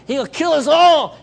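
loud, slow mock-terrified wail; panicked cry. Terrified soldier warning David about Goliath, panicked cry. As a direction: cry it out in hammed-up dread.

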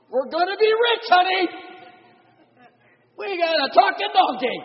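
hammed-up giddy shout to honey; mock excited shout. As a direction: shout to your wife like you struck gold. Imagined excited reaction to donkey.

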